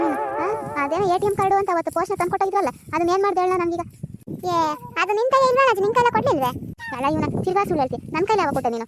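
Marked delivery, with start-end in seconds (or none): 4.5-6.5 high, loud protesting cry; indignant shout. Acted. Accused of stealing, shouting back in indignant denial.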